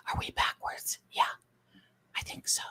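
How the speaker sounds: whispered question to herself. Real, not acted. Streamer rechecking the flipped camera, whispered. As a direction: ask it under your breath.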